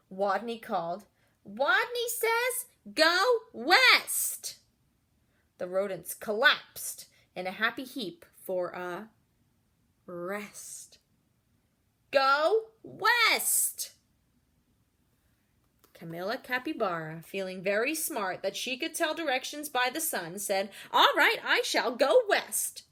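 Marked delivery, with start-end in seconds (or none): 1.5-4.6 high, loud command; strong call. A narrator's reading. Wadney calling loudly to the class at last. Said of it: "In a voice so strong". 12.1-13.9 high, loud command; strong call. A narrator's reading. Wadney calling loudly to the class at last. 20.9-22.8 loud, slow, grand declaration; smug. A narrator's reading. Camilla loftily answering Wadney's order.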